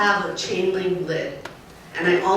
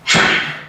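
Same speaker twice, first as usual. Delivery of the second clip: sudden sharp breathy bark of ha, louder; sharp shout ha; 5 dB louder than usual. Shown as a demonstration. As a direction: startle a coyote with one short burst. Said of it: "I'm just gonna go".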